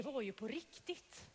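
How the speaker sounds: hushed, secretive whisper; whispered to the children. Acted. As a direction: let them in on the secret, softly. Storyteller's hushed reveal.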